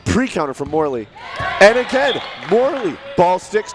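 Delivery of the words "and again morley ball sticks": high excited call; excited shouting. Real, not acted. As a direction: call the catch with a burst. Big catch play-by-play.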